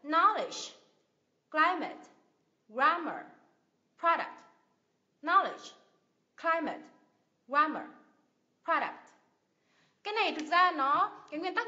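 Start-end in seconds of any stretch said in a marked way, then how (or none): 0.0-9.1 each word from "Knowledge" to "product" punched on its first syllable; first-syllable stress. Shown as a demonstration. Teacher drills nouns for learners to repeat, first syllables stressed. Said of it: "nhấn vào âm tiết thứ nhất".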